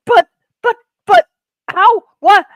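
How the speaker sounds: high, loud, sputtering disbelief; mock-stunned companion, high and loud; stammering in shock. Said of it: "she's like".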